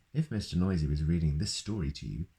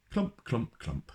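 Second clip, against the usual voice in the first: high sing-song clump sounds; stomping footsteps; 5 semitones higher, slower than usual. A narrator's reading. Giant steps down the hill, playful clumping. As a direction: mimic heavy footsteps.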